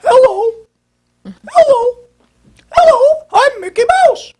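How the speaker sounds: very high, loud, squeaky hello climbing up; Mickey Mouse voice. Shown as a demonstration. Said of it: "But as I go up".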